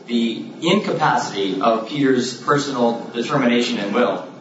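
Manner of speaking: usual speaking voice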